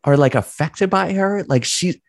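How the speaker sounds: high, lifted voice, bursting with pride; excited pride. Real, not acted. Son raving about his actress mother, high and lifted. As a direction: gush about her like a proud son.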